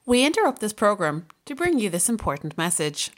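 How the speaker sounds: ordinary talk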